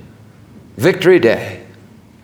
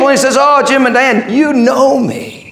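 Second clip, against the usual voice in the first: high, fervent acknowledgement; full-force preaching; 9 semitones higher than usual. Acted. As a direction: preach it high, stretching the last words.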